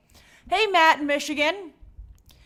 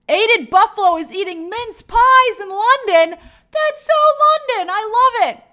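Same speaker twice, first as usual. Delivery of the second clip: excited; 9 semitones higher, 9 dB louder than usual. Real, not acted. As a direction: gush over London with excitement. Excited reaction to London.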